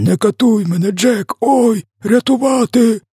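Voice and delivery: very high pleading, ending in a drawn-out howl; screeching. A narrator's reading. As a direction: shriek for mercy like a tortured giant. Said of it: "верещав він". (вереск із благанням про пощаду)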